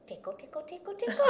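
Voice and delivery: lightly voiced toddler, rising and giggly; a hint of a giggling toddler; baby voice. Imitating baby's tickle game.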